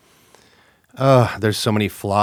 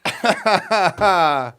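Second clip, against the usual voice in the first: higher burst of laughter; hearty laugh; 8 semitones higher, 3 dB louder than usual. Real, not acted. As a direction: laugh out loud at the silly attempt. Laughing at bad accent attempt.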